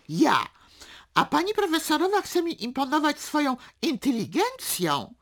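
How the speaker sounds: boastful, loud declaiming; indignant bragging with sudden high, loud bursts; chest-beating shouting. A narrator's reading. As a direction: proclaim it, punching each boast. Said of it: "bijąc się w piersi wołał".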